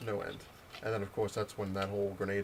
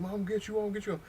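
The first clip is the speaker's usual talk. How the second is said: much higher, squawky imitation; mock agitated threat; 9 semitones higher than usual. Shot man turns around threatening storyteller, mock agitated. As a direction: sputter it like a hurt, riled-up man.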